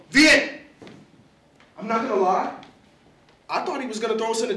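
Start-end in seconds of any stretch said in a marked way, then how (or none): 0.1-0.5 loud, higher one-word order; barked summons to the kids; father's command. Acted. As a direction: call it out sharply like a stern dad. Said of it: "motioned for us to follow".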